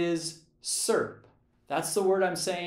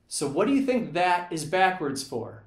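ordinary delivery

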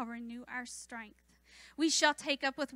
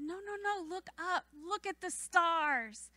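put-on coaxing a toddler; 5 semitones higher than usual. Babysitter urging the toddler to look up, tender coaxing. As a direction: gently point a child to the sky. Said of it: "I said".

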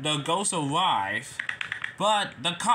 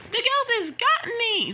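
very high, loud acted wail; panicked cry; 5 dB louder than usual. Nia cries that the ghost has her, panicked. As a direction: cry out like you've been caught.

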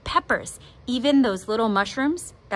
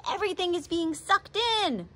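mild shout; 10 semitones higher, 5 dB quieter than usual. A narrator's reading. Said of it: "Dad shouted".

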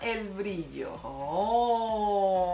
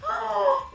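high delighted shriek; excited squeal; 7 dB louder than usual. Real, not acted. Squealing at cute hair product.

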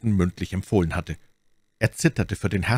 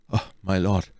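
groaning baron. A narrator's reading. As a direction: groan it out, lightly acted. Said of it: "ächzte der Baron". (leise ächzend vor Kummer)

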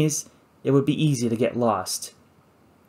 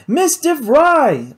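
drawn-out high holler, much louder; 13 semitones higher, 6 dB louder, much slower than usual. Acted. Narrator calling for Kate in the jungle, slow high holler. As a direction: belt her name out slowly into the trees. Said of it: "I hollered".

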